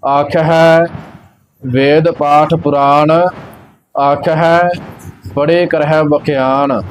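ਆਖ ਹੈ, a long break, then the line; pause after ਆਖ ਹੈ. Shown as a demonstration. Teacher showing where to rest, pause after ਆਖ ਹੈ. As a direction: rest each time after ਆਖ ਹੈ. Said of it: "ਵਿਸ਼ਰਾਮ ਆਖ ਹੈ ਤੇ ਲੱਗੇਗਾ".

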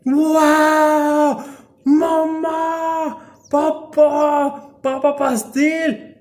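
high, loud, drawn-out cries; playful high-pitched yelling; excited baby-talk shouts. Pretend. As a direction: call out like an overexcited little one. Playful cries while feeding bird.

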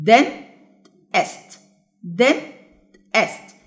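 dentist split as den, pause, est; syllable by syllable. Shown as a demonstration. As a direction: break dentist into two separate beats. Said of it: "يبقى دي كام syllable". (مقطّعة إلى مقطعين بينهما سكتة)

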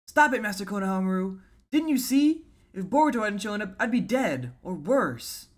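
put-on distressed young ninja. Voices a manga character pleading.

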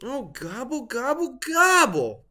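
a hammed-up turkey wail of despair. A narrator's reading. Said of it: "wailed Turkey".

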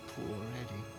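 low, subdued regret; wistful pity. Acted. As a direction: lament him quietly. Mother pities the murdered man.

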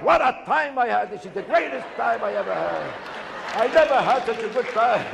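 oversell the wonderful holiday, hammed-up; a tourist faking delight at the card table, mock ecstatic; mock rapture. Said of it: "They come back".

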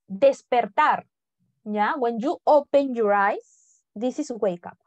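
usual speaking voice

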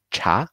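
第二声 茶; rising tone. Shown as a demonstration. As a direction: lift cha up like a question. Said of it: "one more time".

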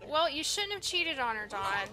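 usual speaking voice

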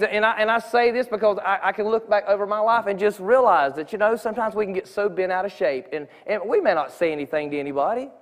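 normal talking voice

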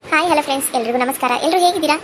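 usual speaking voice

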